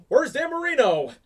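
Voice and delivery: high, loud movie-quote impression; imitated frantic demand down the phone; Ace Ventura. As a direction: call it out high and loud like an excitable detective. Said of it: "remind me of Ace Ventura where he's on the phone".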